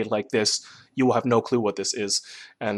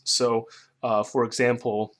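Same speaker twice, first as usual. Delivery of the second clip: ordinary talk, nothing marked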